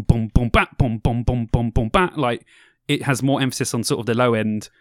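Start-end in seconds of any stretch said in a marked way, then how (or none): 0.0-2.2 heavy boom boom bat, slightly louder; beatboxed dubstep beat. Shown as a demonstration. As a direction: mouth a slow, bass-heavy kick pattern. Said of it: "So it'll be like".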